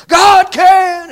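harsh, very high, loud mock crowd yell; imitated wild church response; congregation shouting back. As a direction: holler the response like a fired-up congregation. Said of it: "And all the people say".

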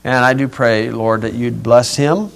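gentle pastoral prayer; closing prayer. Real, not acted. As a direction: pray quietly to the Lord.

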